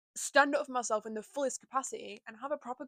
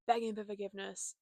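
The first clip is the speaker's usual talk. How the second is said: normal talking voice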